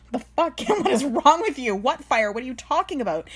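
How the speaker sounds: high, loud exasperated outburst; humiliated ex. Retold or imitated. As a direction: snap at someone embarrassing you in public. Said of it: "my ex is humiliated at this point".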